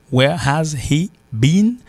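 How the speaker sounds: slow "Where has he been", each word full, rising; as written. Shown as a demonstration. Teacher spelling out grammar, "Where has he been" word by word. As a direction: sound both H's in "Where has he been". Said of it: "w ap wè l ekri".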